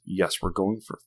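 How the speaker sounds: exasperated retold reply; still pissed. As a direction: act out a fed-up dad giving in. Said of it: "I was still pissed".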